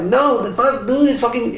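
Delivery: high squeaky mock outburst. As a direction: squeal it like a cartoon figure. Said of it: "That's like".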